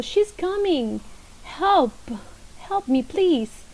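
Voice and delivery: high, alarmed cry for help; loud exclamation. Shown as a demonstration. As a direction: call out like someone in danger. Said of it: "The exclamation mark gets loud".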